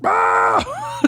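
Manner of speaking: very high fox shriek; screaming fox imitation. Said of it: "what, uh, a screaming fox sounds like".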